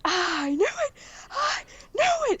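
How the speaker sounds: very high, strained screechy yell; retold yelling. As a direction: re-enact an overexcited shriek. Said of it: "just yelling".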